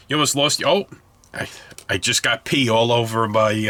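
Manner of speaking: normal talking voice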